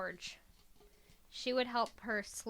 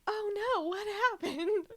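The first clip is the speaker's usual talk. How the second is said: mock shock; 10 semitones higher, 5 dB louder than usual. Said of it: "I was like".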